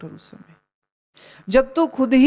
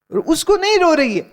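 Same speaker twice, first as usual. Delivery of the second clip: नहीं punched much higher with a falling tune; indignant stress; 7 semitones higher, 3 dB louder than usual. Real, not acted. Preacher tells listeners she isn't crying for him, नहीं stressed. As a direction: hit नहीं hard and let it drop. Exclaims at the wife's selfishness.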